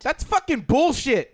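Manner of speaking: loud, slow disbelieving outburst; outraged shout. Real, not acted. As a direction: yell it in mock outrage. Reacting to wrong guess.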